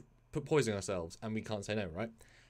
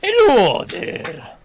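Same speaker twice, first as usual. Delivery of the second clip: very slow, higher, louder puppet greeting; wrong puppet voice; 12 semitones higher, 14 dB louder, much slower than usual. Acted. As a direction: stretch the hello out like a cartoon puppet. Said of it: "that's the wrong voice".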